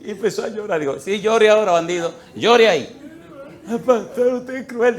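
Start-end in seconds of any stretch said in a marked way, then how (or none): 1.0-3.0 loud, high scolding of a crying man; mocking scold. Pastor scolds the weeping husband in hospital, loud taunt. 3.7-5.0 high, pleading sick-man voice; whiny sick man. Retold or imitated. Husband in hospital pleads with the pastor, high whine.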